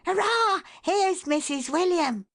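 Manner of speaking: high, loud, gleeful shout; joyful boy calling out; excited child's cry. A narrator's reading. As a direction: burst out with a child's delight. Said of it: "cried Johnny".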